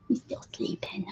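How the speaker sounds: soft unvoiced whisper. Real, not acted. Owner whispering to her sleepy cats. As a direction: whisper so the cats stay calm.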